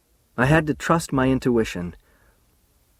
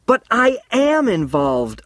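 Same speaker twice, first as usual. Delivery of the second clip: high, slow, raised cry; shouted; 11 semitones higher, 4 dB louder, slower than usual. A narrator's reading. Charlie insisting to Alice he is involved, shouting. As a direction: stretch it out in a loud protest. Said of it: "I shouted".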